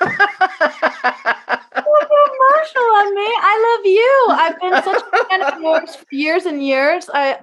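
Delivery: tearful giggle, very high; emotional laughing. Real, not acted. Guest answering the host's thanks, laughing tearfully.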